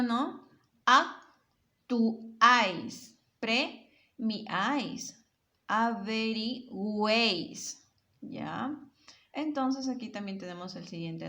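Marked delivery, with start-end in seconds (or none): 1.9-7.8 Actuáis, premiáis, averigüéis each said slowly; slow example words. Shown as a demonstration. Teacher reading triphthong examples slowly to pupils. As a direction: read the word list slowly and clearly. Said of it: "Por ejemplo".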